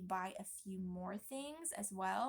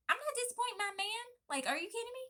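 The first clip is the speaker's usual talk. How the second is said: much higher and louder, hyped up; fired up; 13 semitones higher, 4 dB louder, faster than usual. Acted. Pregnant wife hyping herself up over her husband's support, fired up. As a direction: pump yourself up, almost squealing.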